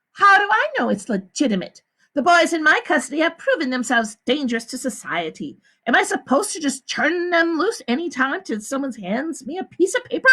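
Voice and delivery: a high, smooth woman's voice; the warden. A narrator's reading. The warden explains to the visitors, high and smooth. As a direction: lie calmly to the visitors.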